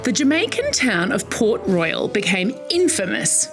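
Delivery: usual speaking voice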